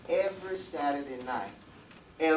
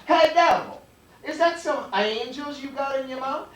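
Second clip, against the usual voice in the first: high, loud call to the devil; man calling out; 13 semitones higher, 9 dB louder than usual. Acted. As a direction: holler it across the yard. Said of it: "the man says".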